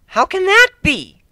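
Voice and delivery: high, slow bewildered question; tiger woman asking. A narrator's reading. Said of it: "a tiger-striped woman asked".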